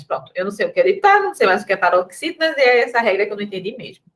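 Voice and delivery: rushed mock complaint; flustered student. Acting a pupil lost on paroxítonas, fast flustered grumble. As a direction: rattle it off like an overwhelmed pupil.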